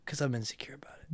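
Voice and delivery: unvoiced whispered confession; whispering. Real, not acted. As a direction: whisper it, a bit embarrassed. Confessing insecurity.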